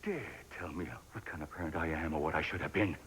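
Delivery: low, quiet warning; controlled anger. Acted. Parent warding off criticism of their parenting, controlled anger. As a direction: forbid it in a hushed, angry voice.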